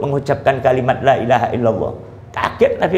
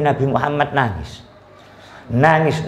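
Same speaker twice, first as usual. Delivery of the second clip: normal talking voice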